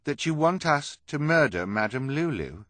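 high, loud character question; hesitant questioner. Acted. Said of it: "Hugo said finally".

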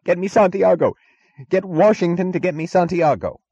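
high, loud yell into a receiver; high-pitched, desperate barked demands; frantic yelling. A narrator's reading. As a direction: yell urgent orders down the phone. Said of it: "Jim kept yelling into one receiver".

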